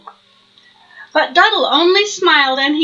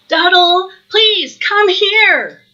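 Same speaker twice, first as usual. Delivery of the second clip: hollered call; 6 semitones higher, 3 dB louder, slower than usual. Acted.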